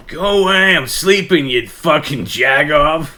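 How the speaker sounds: high, loud, slow put-on grumpy voice; hammed-up sleep-talk rant; mock sleepy grumble. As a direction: yell it like a half-asleep grouch. Imitating sleeping friend's grumble.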